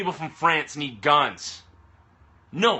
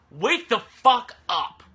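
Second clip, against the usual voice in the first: slow, higher and louder, spitting each word; angry command; 6 semitones higher, 4 dB louder, much slower than usual. Real, not acted. Fed-up atheist ordering believers to wake up, slow and loud. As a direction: bark it out like a final demand.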